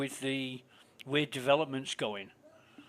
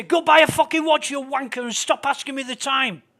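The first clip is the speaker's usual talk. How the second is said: much higher and louder hammed-up yell; mock snapping shout; 11 semitones higher, 10 dB louder than usual. Imagined rude guy snaps at someone asking the time, hammed-up shout. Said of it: "Just snap at 'em".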